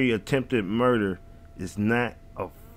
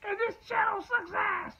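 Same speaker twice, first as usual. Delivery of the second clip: much higher mock squeal on sucks ass; joking squeal; 6 dB quieter than usual. Vlogger roasting his own channel in a high squeal. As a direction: shriek the self-roast for a laugh.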